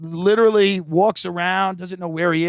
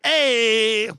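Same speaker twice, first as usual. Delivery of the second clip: higher, overjoyed guard voice; host mimics the cheerful guard, higher; happy guard; 5 semitones higher than usual. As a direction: crow it like a proud tour guide.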